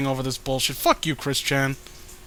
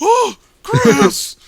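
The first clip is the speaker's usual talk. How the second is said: much higher and louder exasperated cry; a theatrical groan of disbelief; mock exasperation; 10 dB louder than usual. Mock dismay at Chris.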